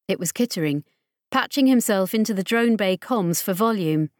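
usual speaking voice

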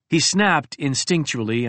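ordinary delivery

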